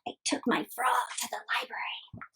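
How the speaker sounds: soft whispered title. Acted. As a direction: whisper it secretly.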